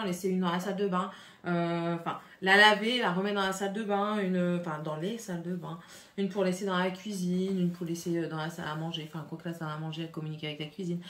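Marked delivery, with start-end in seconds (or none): none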